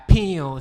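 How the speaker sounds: one loud mock gunshot; finger-gun pew. Cousin shooting him in the face, loud mock pew. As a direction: snap it out like a toy gun firing. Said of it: "You gonna die".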